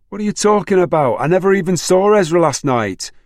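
high, loud furious outburst. A narrator's reading. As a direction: blurt it out in a fury. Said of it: "almost jumping up and down in her fury".

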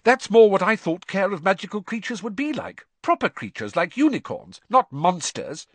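lightly voiced schoolgirl; girl praising the new teacher; Parvati voice.

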